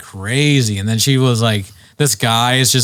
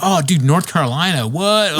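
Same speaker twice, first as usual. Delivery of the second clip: higher put-on dude voice; imitating the boyfriend; 6 semitones higher than usual. Boyfriend excited about North Carolina, in a higher mock dude voice. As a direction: gush like a thrilled bro. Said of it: "He's like".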